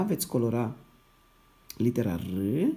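normal talking voice

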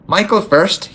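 high, stubborn insistence; dogged. A narrator's reading. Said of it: "he said doggedly".

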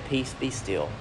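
soft, low, calm command; Jesus calming the storm, soft and low. Retold or imitated. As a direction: still the storm with a hush.

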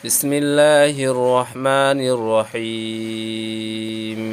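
slow, melodic Quranic recitation. Shown as a demonstration. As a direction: draw out every syllable. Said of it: "recites before next surah".

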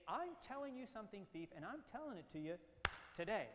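"I'm telling you" starts high, then a pause and TODAY hit hard; emphatic TODAY. Shown as a demonstration. Teacher showing the verse's emphatic sense, stress on today. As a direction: hold back, then land "today" loud and falling. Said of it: "in an emphatic sense".

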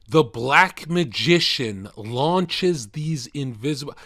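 dramatic reading. Acted. Rereads passage, lisping.

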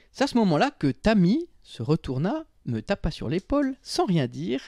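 lively, swooping storytelling; story narration. A narrator's reading. Narrator builds up to the horrible cookie spectacle, dramatic narration. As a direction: build up the comic reveal with punched words.

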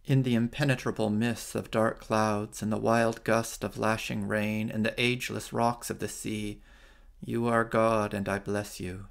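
ordinary talk

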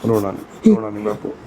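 very low, soothing murmur to a crying elder; calm a weeping loved one, pitched right down; tender consoling. Real, not acted. Consoling a weeping old man. (ਬਹੁਤ ਨੀਵੀਂ ਸੁਰ ਵਿੱਚ ਪਿਆਰ ਨਾਲ ਵਰਾਉਂਦਿਆਂ)

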